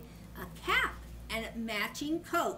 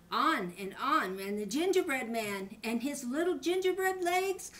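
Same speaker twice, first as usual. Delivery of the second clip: plain reading voice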